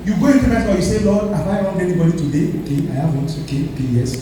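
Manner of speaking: usual speaking voice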